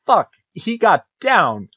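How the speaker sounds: rising mock rage at the cartoon's writers; retold angry outburst. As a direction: rant at the TV, half-joking fury. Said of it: "I'm, like, actually angry".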